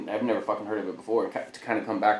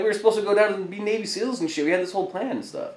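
high, raised-voice disbelief; indignant protest; 12 semitones higher, 5 dB louder than usual. Retold or imitated. Recruit to best friend who enlisted without him, indignant. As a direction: push it out as a wounded retold complaint.

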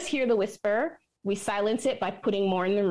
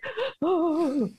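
high gasp; 8 semitones higher than usual. Retold or imitated. Reaction to children dancing.

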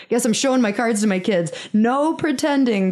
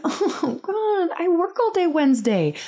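high-pitched exasperated inner whine; acted-out dread, pitched well up; inner groan; 10 semitones higher than usual. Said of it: "So in my head, I'm just like".

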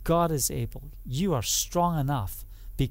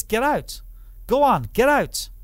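higher and louder, ordering them out; commanding order; 6 semitones higher, 4 dB louder than usual. Acted. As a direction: clear the room firmly. Yeshua orders mourners out.

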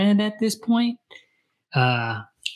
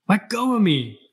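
iron in his voice; 7 semitones higher, 3 dB louder than usual. A narrator's reading. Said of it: "She heard the iron in his voice".

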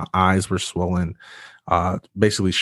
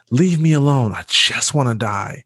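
retold yell; 5 semitones higher than usual. Patient yelling at the doctor to leave him alone. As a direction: shout him away, then sink. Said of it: "I'm yelling at him".

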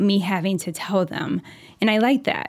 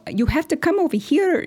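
put-on kids' Spanglish accent; 8 semitones higher than usual.

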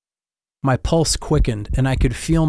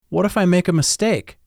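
higher, worried small question; anxious wondering; 6 semitones higher, faster than usual. A narrator's reading. Boy asking teacher, higher and anxious. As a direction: voice the boy's nervous doubt. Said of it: "I wondered out loud".

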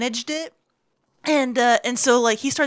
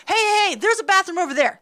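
urgent; 7 semitones higher, faster than usual. Retold or imitated. Retold urgent redirect.

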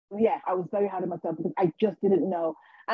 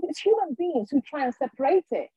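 higher, eager vicar imitation; happy vicar; 6 semitones higher than usual. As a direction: explain it like an enthused vicar. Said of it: "so happy for me".

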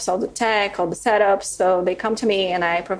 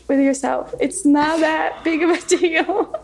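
higher, laughing, a lightly mimicked coach; coach telling her to relax; 10 semitones higher than usual. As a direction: chuckle as you tell her to just relax. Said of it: "she's like".

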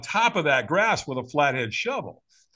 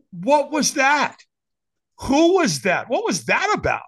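high, loud retold disbelief; bewildered; 10 semitones higher, 5 dB louder than usual. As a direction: react baffled to what just happened. Acts out his bewildered reaction.

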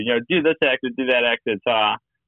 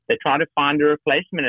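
normal talking voice

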